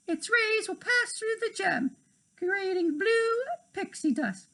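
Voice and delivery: high put-on minister voice; minister character. A narrator's reading. The minister announcing the scepter task, high put-on voice. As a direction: read the minister's lines in a lifted voice.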